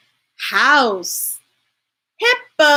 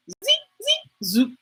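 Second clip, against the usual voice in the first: squeaky voice; 11 semitones higher, 5 dB quieter than usual. Pretend. Squeaky transition sound.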